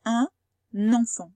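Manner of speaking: Un enfant, N linked into enfant; liaison demo. Shown as a demonstration. Teacher modelling liaison words, carefully. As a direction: say Un enfant as unenfant. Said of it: "il a fait comme s'il y en avait un deuxième".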